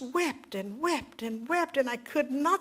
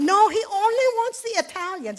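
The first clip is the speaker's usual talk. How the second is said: mock Italians' protest; 10 semitones higher, 4 dB louder than usual. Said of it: "the Italians said".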